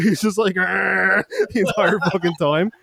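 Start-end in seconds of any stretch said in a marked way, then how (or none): 0.6-1.2 long high-pitched "argh"; put-on droning scream; mock wail. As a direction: imitate a steady drawn-out yell. Imitates David's wail while dragged away.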